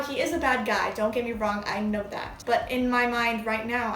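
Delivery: normal talking voice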